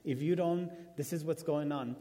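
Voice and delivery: ordinary talk, nothing marked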